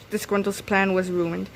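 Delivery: usual speaking voice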